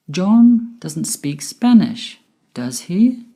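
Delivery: "does he?" rising at the end, unsure; rising intonation. Shown as a demonstration. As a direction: lift the pitch on "does he?" as a real question. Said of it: "If the intonation of the question tag goes up".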